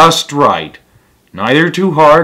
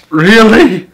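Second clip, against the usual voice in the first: high, loud disbelieving question; mock disbelief at the silly picture; incredulous; 10 semitones higher, 7 dB louder than usual. Real, not acted. Viewer reacting to Goldilocks upside down, loudly incredulous.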